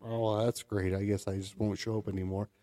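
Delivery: low, soft, resigned mimicry; mock sulking. Imitating his co-host's reaction to guests, mock sulking. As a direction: sulk quietly as the slighted co-host. Mocking a sulky co-host.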